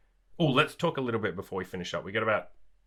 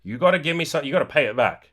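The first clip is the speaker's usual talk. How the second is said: louder, pushy demand for what he's owed; mock entitled demand; 5 semitones higher, 6 dB louder, faster than usual. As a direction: insist like someone calling in a debt. Nice guy demanding repayment.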